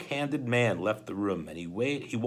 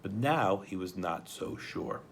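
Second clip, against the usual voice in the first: ordinary delivery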